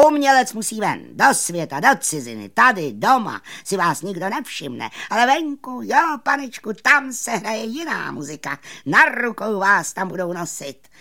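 loud, high, swooping sales pitch; showman magician. Acted. Magician luring musicians into the world, loud high persuasion. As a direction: sell it grandly with punched words.

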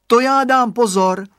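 high, loud confident promise; a boy eager to go out; eager child. A narrator's reading. Radovan reassuring his mother, eager promise.

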